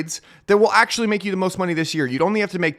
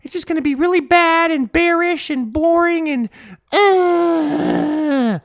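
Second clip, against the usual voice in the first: high, mock-whiny moaning; whiny bears; 11 semitones higher, 5 dB louder than usual. Mocking gloomy bears calling for a bad summer, high whine. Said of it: "They're like".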